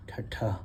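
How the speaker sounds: ठट्ठा with an aspirated retroflex ठ; Punjabi letter sound. Shown as a demonstration. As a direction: say ठट्ठा with a breathy, heavy retroflex ठ. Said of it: "इसकी heavy sound है टी से".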